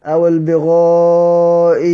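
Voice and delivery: البغائين drawn out in tajweed recital; slow tajweed demo of the same rule; slow recitation. Shown as a demonstration. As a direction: recite البغائين slowly with the change. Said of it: "نفس النظام".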